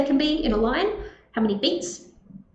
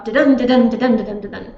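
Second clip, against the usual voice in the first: bouncing "da-dun" beat, weight on each "dun"; unstressed-stressed pattern; 5 dB louder, faster than usual. Shown as a demonstration. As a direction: chant "da-DUN" as a steady iambic pulse. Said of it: "that unstressed, stressed pattern".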